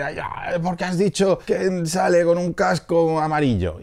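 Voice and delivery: whiny high mock fan complaint, slowed; a hammed-up nitpicking viewer; mock angry commenter. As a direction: imitate a fussy fan nagging. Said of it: "va a venir la gente".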